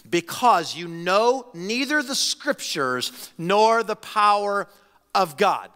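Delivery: slow, weighty reading with NEITHER leaned on; slow solemn scripture reading; slow emphatic reading. Shown as a demonstration. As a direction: lay each word down slowly, like a verdict. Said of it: "You don't know".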